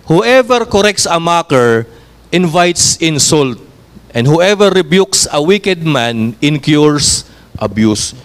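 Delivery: slow, measured Bible reading; scripture reading. Acted. Preacher reading Proverbs to the church, slow and measured. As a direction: read the verse slowly for the congregation. Reads Proverbs verse aloud.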